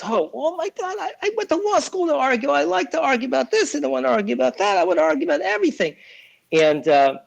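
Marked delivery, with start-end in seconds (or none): 0.0-5.9 very high, fast whiny lawyer voice; mock the argumentative lawyers in a squeal; hammed-up high complaining lawyer. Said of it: "And lawyers".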